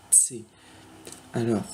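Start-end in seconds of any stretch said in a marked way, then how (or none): 0.1-0.4 C as a soft-spoken "tsé"; German letter C. Shown as a demonstration. Teacher repeating the German C for learners, softly as "tsé". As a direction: put a small T before C, "tsé", quietly. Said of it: "comme s'il y avait un petit T devant".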